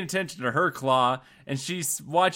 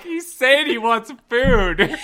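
laughing explanation; 8 semitones higher, 7 dB louder, slower than usual. Retold or imitated. Dad explaining, laughing at mom.

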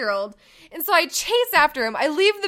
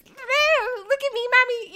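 put-on much higher whiny child; whiny kid voice; 11 semitones higher than usual. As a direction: imitate a clingy child whining. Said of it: "kids are in there crying and grabbing".

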